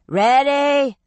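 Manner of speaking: loud, high call over the storm; yelling over wind. A narrator's reading. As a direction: shout it against the wind. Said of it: "Shay yelled against the wind".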